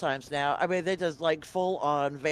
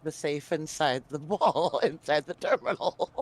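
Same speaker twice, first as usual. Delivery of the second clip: laughing. Real, not acted. Teasing a fellow player about the hidden safe, laughing. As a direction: say it through real giggles. Still amused by the terminal mix-up.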